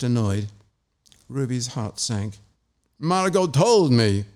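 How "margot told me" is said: seething. Acted. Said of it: "he seethed".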